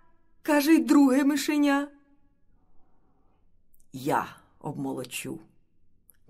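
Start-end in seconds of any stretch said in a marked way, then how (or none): 3.9-5.4 low, soft rooster's reply; subdued and low; quiet resignation. A narrator's reading. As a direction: take on the chore quietly, pitched low. Rooster resigns himself to the work.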